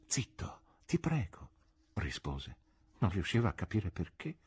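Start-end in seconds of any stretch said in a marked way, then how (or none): 0.1-1.5 urgent, pleading whisper; hushing whisper. A narrator's reading. Embarrassed friend hushing a drunk in theatre, whispering. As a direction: whisper it so the audience won't hear.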